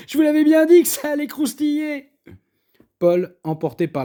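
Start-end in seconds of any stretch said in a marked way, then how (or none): 0.1-2.1 loud, high triumphant tease; gleeful gloating. A narrator's reading. Cook mocking Paul's sandy meat, loud high gloating. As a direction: crow it through the giggles.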